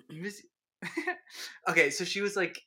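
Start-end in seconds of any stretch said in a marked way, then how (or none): none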